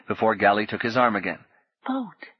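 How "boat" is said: put-on high, hushed boy; whispered. Boy guiding Paul to the boat, hushed. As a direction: breathe the word to him. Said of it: "the boy whispered".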